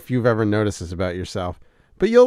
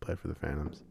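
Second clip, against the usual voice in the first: quiet, low, solemn voice; hushed grief; 7 semitones lower, 13 dB quieter than usual. Pretend. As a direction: fake a somber confession. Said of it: "call her up and be like".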